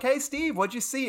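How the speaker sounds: high-pitched friendly hail; calling out. Retold or imitated. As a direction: call to someone from a car window. Students hailing professor from car.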